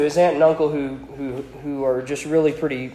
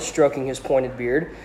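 ordinary talk, nothing marked

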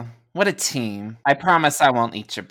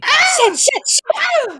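panicked cursing; 5 dB louder than usual. Acted. Ranger dodging laser fire, shrieking in panic. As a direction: scream it while dodging sparks. Sparks fly around girls.

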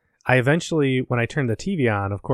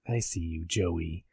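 low, soft ominous voice; lightly voiced menace, hushed and low; a hint of a creepy stalker; creepy recording; 6 semitones lower, 8 dB quieter, slower than usual. Imitating the creepy recorded message.

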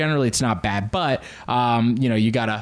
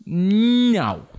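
drawn-out high "no", hammed up; emphatic refusal; 7 semitones higher than usual. The body rejecting too much popcorn, mock protest. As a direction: swoop up and wail the refusal.

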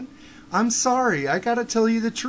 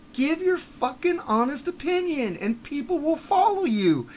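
passionate urging; 7 semitones higher than usual. Real, not acted. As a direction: push higher with feeling. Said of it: "urging him passionately".

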